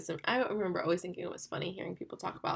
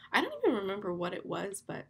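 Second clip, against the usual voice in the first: ordinary talk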